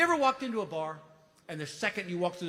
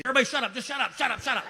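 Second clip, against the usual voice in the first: shouting at crowd; 8 semitones higher, 5 dB louder, much faster than usual. Real, not acted. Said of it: "comedian shouts at audience".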